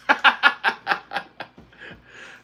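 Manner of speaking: high wheezing burst of laughter. Real, not acted. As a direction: breaks into a high airy laugh. Laughing at a viewer's comment.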